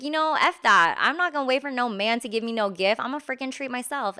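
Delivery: sassy, fast declaration to herself; defiant self-talk. Retold or imitated. To herself after no Valentine's gift, sassy and defiant. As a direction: announce it like a vow, quickly. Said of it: "so I was like".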